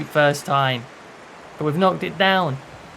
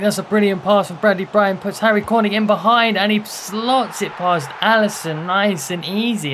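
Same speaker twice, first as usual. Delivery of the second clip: excited goal call; 5 semitones higher, 5 dB louder than usual. Real, not acted. His team scores against Liverpool.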